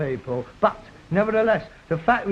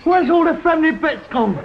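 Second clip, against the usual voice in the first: "Where's all the friendly bits gone?" said higher and louder; exasperated outcry; 8 semitones higher, 4 dB louder than usual. Acted. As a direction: cry out "Where's" in fed-up protest. Same complaint repeated, louder.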